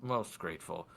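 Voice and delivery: formal, weighty request. Acted. Lord asking guard to investigate, deliberate formal voice. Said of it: "do my best for his voice".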